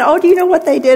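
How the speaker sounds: a hammed-up nosy chatterer; mock TV gossip. Preacher mocking TV gossip about sin, silly high voice.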